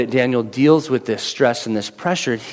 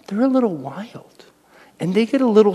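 whispered aside; 5 semitones higher than usual. Real, not acted. Aside about his boys.

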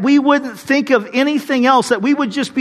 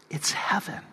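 whispered; 5 semitones lower, 13 dB quieter than usual. Real, not acted. Preacher's hushed punchline.

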